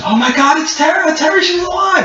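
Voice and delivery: very high, shrieky mock-mother voice; high-pitched ecstatic mother; overjoyed mother. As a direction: cry out in astonished joy, far above your own pitch. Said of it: "and then your mother comes".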